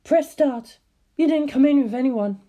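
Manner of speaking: high, sugary receptionist; a high front-desk voice with an edge; suspicious receptionist. Acted. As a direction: receptionist catching an intruder, high and sweet. Receptionist turning strange.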